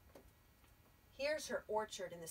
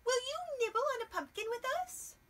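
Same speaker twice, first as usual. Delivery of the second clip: much higher, quick little mouse; 13 semitones higher, 5 dB louder, much faster than usual. Acted. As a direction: ask it like an eager little mouse. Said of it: "said Mouse".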